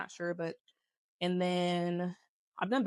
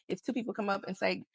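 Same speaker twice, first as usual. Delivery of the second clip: normal talking voice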